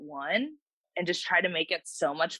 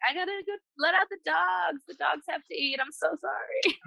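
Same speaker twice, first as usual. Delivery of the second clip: mock excuse; 10 semitones higher than usual. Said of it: "I'm like".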